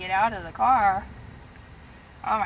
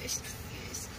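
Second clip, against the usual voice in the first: whispering; 9 semitones lower, 13 dB quieter than usual. Real, not acted. Whispering to the dog.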